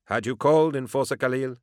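high, mocking sweet tone; teasing. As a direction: tease him playfully. Pilot teasing enforcer.

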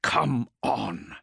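urging, stress on Come; gritted teeth. A narrator's reading. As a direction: push Come out through clenched teeth. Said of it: "he urged through gritted teeth".

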